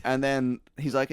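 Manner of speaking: usual speaking voice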